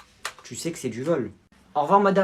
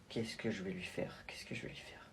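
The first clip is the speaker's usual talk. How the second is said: whisper; 10 semitones lower, 20 dB quieter than usual. Acted. Wondering what to do, in hiding.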